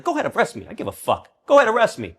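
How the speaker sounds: loud, high taunting at the cops; defiant yell. Retold or imitated. Taunting cops at a protest, loud defiant yell. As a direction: dare them to arrest you, yelling. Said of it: "I'm like".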